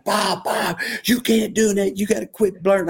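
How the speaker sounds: imitating Lance's high, scolding yell; mock player yelling. Player scolding his coach after getting smashed, mock high yell. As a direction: scold the coach like a player who just got hit. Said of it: "He was yelling".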